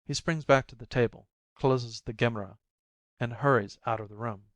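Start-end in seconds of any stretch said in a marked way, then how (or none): none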